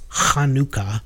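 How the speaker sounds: "Hanukkah" opening on a throat-clearing guttural h; "Hanukkah" the Hebrew way, with a raspy throaty first sound; Hebrew pronunciation. Shown as a demonstration. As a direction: start "Hanukkah" with a hard scrape in the throat. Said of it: "it's actually pronounced".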